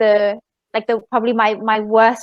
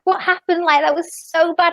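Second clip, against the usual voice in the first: higher-pitched, mock-upset quote; 7 semitones higher than usual. Friend meeting her after coming off stage, mock upset. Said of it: "She was so upset".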